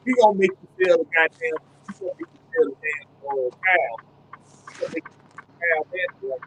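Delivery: muffled, like through a wall; bad mic, voice sounds muffled. Real, not acted. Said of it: "you sound muffled as hell".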